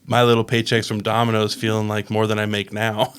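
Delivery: ordinary delivery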